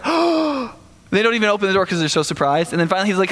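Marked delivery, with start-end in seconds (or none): 0.1-0.7 a high, stunned "oh"; startled gasp. Retold or imitated. The praying church hears Peter at the door, stunned and high. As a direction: caught off guard, pitched much higher.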